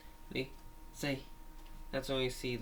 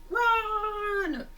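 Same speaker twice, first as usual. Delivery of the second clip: shouting; 6 dB louder than usual. Retold or imitated. Child acting out cartoon shout.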